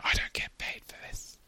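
whispered aside; hushed joking aside. Real, not acted. Aside joke under the talk.

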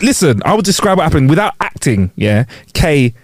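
ordinary talk

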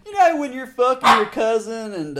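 high loud country twang. Pretend. Redneck joke voice.

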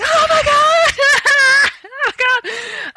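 high, loud, stretched-out shriek of joy; excited scream. Retold or imitated. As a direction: squeal it like you just got the part. Screams after hanging up the phone.

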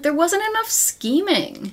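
high, drawn-out whine of protest; mock complaint. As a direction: stretch it out high in mock disappointment. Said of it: "coming out of it and being like".

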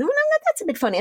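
high, puzzled self-talk; wondering what that spot is. Retold or imitated. Retold self noticing spot.

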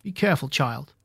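plain reading voice

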